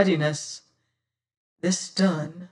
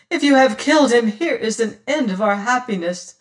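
louder, higher anguished plea rising to a cry; horrified cry; 4 semitones higher, 4 dB louder than usual. Acted. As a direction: cry it out in horror. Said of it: "her eyes wide with horror".

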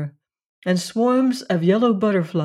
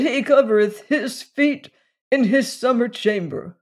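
put-on higher, intoned old preacher's answer; old circuit rider; 6 semitones higher than usual. A narrator's reading. Old preacher answers Abner from the saddle, intoned. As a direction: intone it like scripture, pitched up.

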